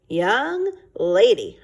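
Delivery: stern father. Acted. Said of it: "he was not happy".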